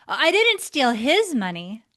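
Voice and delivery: "didn't" ditekankan, lebih keras, naik di akhir; emphatic, didn't stressed. Shown as a demonstration. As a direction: tegaskan kata "didn't" dengan suara lebih keras. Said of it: "you can see how the same words deliver a very different message".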